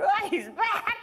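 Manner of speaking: high, laughing mock panic; freaked out. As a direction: squeal it through laughter. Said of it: "I was so freaked out".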